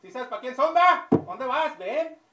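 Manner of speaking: high, loud insistent nagging; pushy questioning. Acted. Customer loudly pressing the waiter about the cups. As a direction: lean on the waiter hard.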